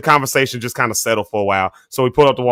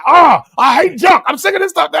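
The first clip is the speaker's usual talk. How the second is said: mock angry dad; 13 semitones higher than usual. Dad after the furniture spilled on the road, shouting angrily. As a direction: yell it like a fed-up dad. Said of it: "He was mad".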